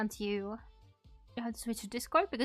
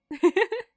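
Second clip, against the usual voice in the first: high giggle; 9 semitones higher, 10 dB louder than usual. Real, not acted. High laugh.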